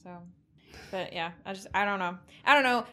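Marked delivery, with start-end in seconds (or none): 2.4-2.8 louder and higher, an exasperated outburst; exasperated louder repeat. Real, not acted. Podcast host giving up on her point, in an exasperated louder voice. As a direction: throw up your hands on the repeat.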